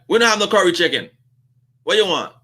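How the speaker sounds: fast, impatient brush-off; rushed and annoyed counter lady; curt cashier. Retold or imitated. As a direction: rattle it off like the line's too long. Cashier lady snaps at customer.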